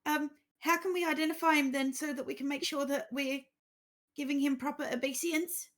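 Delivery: high, loud, flustered gasp; panicked sudden deference; flustered shock. Acted. Acolyte shocked at news.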